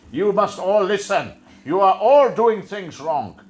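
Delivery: loud, barked caricature commands; Nazi stormtrooper impression. Host mocks Priti Patel as a stormtrooper, barking impression. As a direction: bark orders like a stormtrooper. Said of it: "a Nazi stormtrooper and goes".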